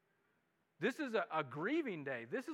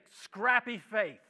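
Scrappy Faith slowed and leaned on; sermon title; 5 dB louder, much slower than usual. Shown as a demonstration. Pastor hands the congregation the sermon title, slowly and firmly. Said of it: "just say".

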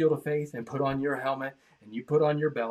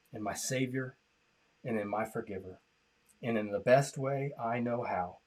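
softer, lower prayer voice; quiet prayer; 5 semitones lower, 6 dB quieter than usual. Acted. Pastor leads online listeners in the sinner's prayer, quietly. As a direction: lead the prayer gently.

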